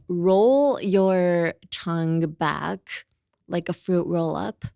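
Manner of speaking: very slow, word-by-word coaching; slow instruction. Shown as a demonstration. Teacher tells learners how to make R, slowly instructing. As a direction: spell out the movement slowly.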